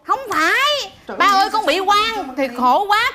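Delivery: high, drawn-out plea of innocence; aggrieved protest. Acted. Manicurist tells father she is wrongly blamed, aggrieved.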